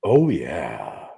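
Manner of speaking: low, husky and slow; sultry voice. Real, not acted. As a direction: let it purr out low and husky. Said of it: "my sultry voice right now".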